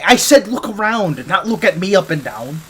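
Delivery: high, loud, flustered telling-off; flustered scolding. Acted. Scientist scolding the agent for looking her up and down. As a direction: snap back, embarrassed and scandalised.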